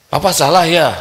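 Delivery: high, loud accusing wife; wife's accusing voice. Acted. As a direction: demand it like an angry wife. Wife confronts husband in quarrel. (suara istri yang menuduh)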